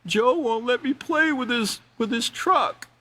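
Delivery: put-on high whiny kid voice, complaining to the teacher; whiny little boy. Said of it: "And Johnny's like".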